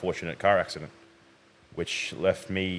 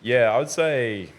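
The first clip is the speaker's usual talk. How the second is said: slow, louder, higher, unnaturally measured; oddly formal delivery; 5 semitones higher, 7 dB louder than usual. Real, not acted. Guest starting his backstory to the host, oddly formal delivery. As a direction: stretch it out in a weirdly put-on way. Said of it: "Why are you talking like that? That's weird. Talk normal".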